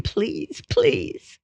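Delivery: thin, softened mock scream; imitated scream. Imitated hysterical wife chased by husband, restrained screamed plea. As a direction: scream it in miniature, held back. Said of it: "she'd scream".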